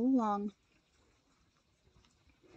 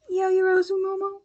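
incredulous; 9 semitones higher, 4 dB louder than usual. Acted. Disbelieving echo of a name.

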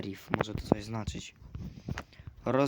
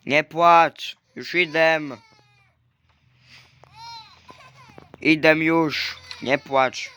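loud pleading; 4 semitones higher, 11 dB louder, much slower than usual. Real, not acted. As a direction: call over the crying, loud and insistent. Shouting at crying game baby. (głośno, błagalnie do płaczącego dziecka)

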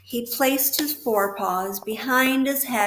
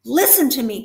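loud urgent cry; 4 semitones higher, 8 dB louder, faster than usual. A narrator's reading. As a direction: cry out to get his attention. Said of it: "cried Wilbur".

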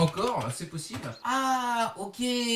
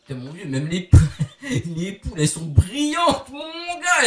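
éclat de rire; 6 dB louder than usual. Real, not acted. Poules brillantes, rire et cri.

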